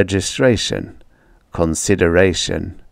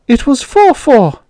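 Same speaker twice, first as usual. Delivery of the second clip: put-on childish voice; 5 dB louder than usual. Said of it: "I said".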